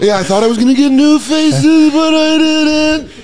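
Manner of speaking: high, loud whiny complaint; whiny high comic voice. Retold or imitated. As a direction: whine it like a bitter comic.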